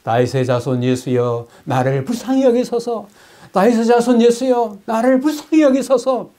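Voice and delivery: rising high, over the crowd; crying out loud. Retold or imitated. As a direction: shout to be heard above everyone. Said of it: "큰 소리로". (군중 소리보다 더 크게 높여 외치는 애원)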